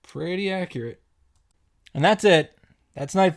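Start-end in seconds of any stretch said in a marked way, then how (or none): none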